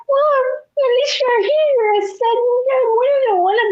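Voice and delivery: very high, crying-laugh voice; laughing through quotes. Retold or imitated. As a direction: squeak it out laughing. Retold dialogue while laughing.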